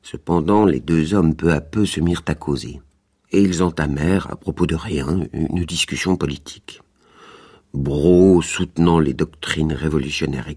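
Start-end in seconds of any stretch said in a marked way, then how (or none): none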